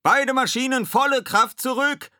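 high, loud command shout; urgent high bellow; bellowed order. A narrator's reading. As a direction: roar it to the engine room. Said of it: "brüllte Ed".

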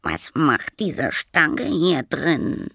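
high, slow, loud little voice; childlike voice calling. Acted. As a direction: complain slowly in a high child's voice. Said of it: "rief die Stimme". (hohe, gedehnte Kinderstimme)

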